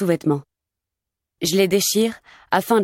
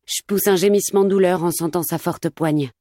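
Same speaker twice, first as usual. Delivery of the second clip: ordinary delivery, nothing marked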